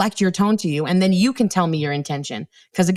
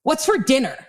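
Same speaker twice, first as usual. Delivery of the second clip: higher, mock-snippy "What's for dinner?"; mimicking the rude tone; 6 semitones higher than usual. Shown as a demonstration. Mother parroting her daughter's snippy question in a mock-harsh tone. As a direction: reflect the kid's harsh tone back. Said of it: "Or did you mean".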